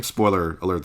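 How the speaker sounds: usual speaking voice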